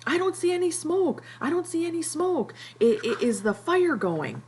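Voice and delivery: Piggy losing it over the fire, acted for the class; frantic yell. Piggy yelling to Ralph that there's no smoke, high frantic. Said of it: "Piggy starts yelling".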